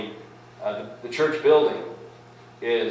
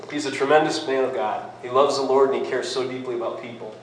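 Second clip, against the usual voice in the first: ordinary delivery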